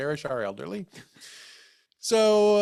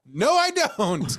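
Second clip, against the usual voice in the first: very slow, high, louder drawn-out no; dismissive no; 7 semitones higher, 5 dB louder, much slower than usual. Retold or imitated. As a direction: toss it over your shoulder. Answering over shoulder, storming out.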